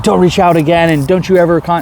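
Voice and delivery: a lightly voiced stern girl's warning; mocking her demand. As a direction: parody her snapping an order. Quoting girl's angry demand.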